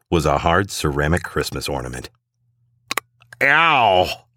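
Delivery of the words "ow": sharp high yelp of pain; a quick high-pitched ouch. A narrator's reading. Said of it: "yelps the ugly sweater guy".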